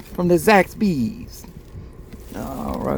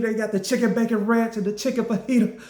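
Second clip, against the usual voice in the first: normal talking voice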